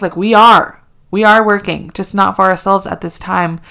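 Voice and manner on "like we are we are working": louder, stress on are; we ARE working, insisted loudly; emphatic retort. Real, not acted. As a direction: punch are hard. Retorting to Kim's advice.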